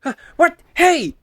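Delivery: high, loud alarmed outburst; shocked to find his ears; startled shout. Acted. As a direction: jump at the sudden discovery. Finds his missing cat ears.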